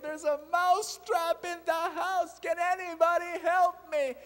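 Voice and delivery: squeaky little mouse begging anyone for help; pleading mouse. Acted. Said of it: "the mouse went on walking and saying".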